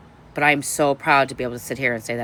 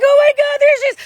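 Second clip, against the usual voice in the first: excited squeal; 11 dB louder, faster than usual. Retold or imitated. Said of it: "I was like".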